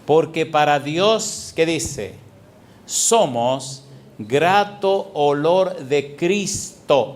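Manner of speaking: drawn-out, deliberate verse; slow scripture reading. Shown as a demonstration. Preacher slowly reads the verse to the congregation. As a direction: read each word slowly. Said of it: "¿Qué dice el versículo quince?".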